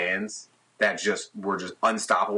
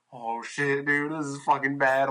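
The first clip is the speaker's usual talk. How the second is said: mild retold panic; 5 semitones higher than usual. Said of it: "I'm, like, losing my shit".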